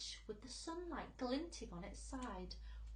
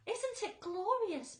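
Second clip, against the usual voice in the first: high, bright delight; 12 semitones higher, 7 dB louder, slower than usual. A narrator's reading. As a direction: marvel at it. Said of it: "Miss Spider said".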